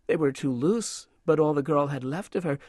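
plain reading voice

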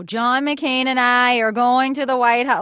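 loud, high, slow stump-speech cadence; Sarah Palin. Shown as a demonstration. Comedian demonstrates the candidate on the stump, in public speech cadence. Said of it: "there's a kind of a speech cadence".

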